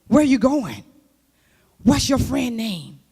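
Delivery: loud, firm questioning mom; strict mother. Retold or imitated. Mother questioning her child before going out, loud and firm. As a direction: grill the kid like a protective mother.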